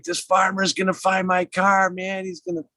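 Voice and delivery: high-pitched, frantic panic; freaking out. Retold or imitated. Friend panicking that the farmer will find his car, high frantic voice. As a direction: imitate a friend panicking in a cornfield. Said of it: "And he's freaking out".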